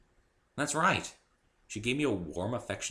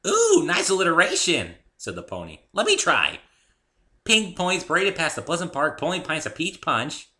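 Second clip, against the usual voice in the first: higher, louder giddy pony; delighted pony; 10 semitones higher, 9 dB louder than usual. A narrator's reading. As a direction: play with words like a giddy pony.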